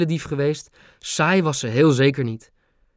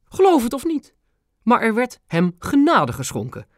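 high, amused royal tease; queen teasing with a twist; wry queen; 9 semitones higher than usual. A narrator's reading. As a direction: let a crooked smile into it. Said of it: "met een scheve glimlach".

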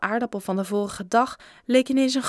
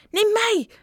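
high, loud squeal of eagerness; yet another child; 13 semitones higher, 5 dB louder than usual. A narrator's reading. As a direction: beg to be chosen. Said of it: "zei nog een andere". (hoge, gretige kinderstem)

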